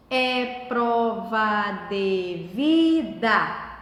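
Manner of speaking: "É prova de vida" very slow and low, each syllable drawn out separately. Shown as a demonstration. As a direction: chant out every syllable of "É prova de vida", lifting on "vi". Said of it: "Separar as sílabas". ("É prova de vida" bem devagar, separando as sílabas)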